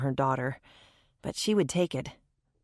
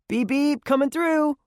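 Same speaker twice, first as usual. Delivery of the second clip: put-on much higher, bouncy kid voice; playful boy's warning; 10 semitones higher, 4 dB louder than usual. A narrator's reading. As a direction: toot like a kid playing car, high. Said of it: "Blake warned".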